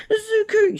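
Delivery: high, loud cry of a name; calling out. Acted. Mom rushes in crying out her son's name, high and loud. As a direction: call him like a frantic mother.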